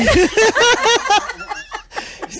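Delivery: bright burst of laughter; high laugh. Real, not acted. Host laughs at breath joke.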